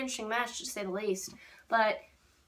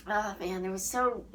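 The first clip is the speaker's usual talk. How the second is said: ordinary talk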